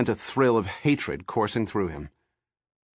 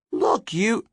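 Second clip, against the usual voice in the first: shrill protest; 13 semitones higher than usual. A narrator's reading. Wife cut off.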